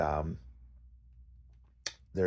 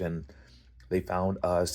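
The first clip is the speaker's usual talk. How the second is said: normal talking voice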